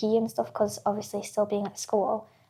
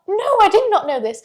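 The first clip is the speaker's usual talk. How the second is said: loud, high-pitched mock outrage; indignant retort; 10 semitones higher, 10 dB louder than usual. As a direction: fire back loudly as the wronged mum. Mum's retort in retold row.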